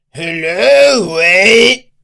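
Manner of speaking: high, shouted Mexican greeting; stretched, blaring Mexican holler; exaggerated Mexican accent. Shown as a demonstration. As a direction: belt it out in an over-the-top Mexican accent. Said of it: "That's an exaggerated accent".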